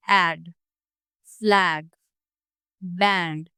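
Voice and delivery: ऐड, फ्लैग, बैंड with the mouth wide open on the a; careful demo of the open front vowel. Shown as a demonstration. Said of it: "mouth है वो थोड़ा सा wide open होता है".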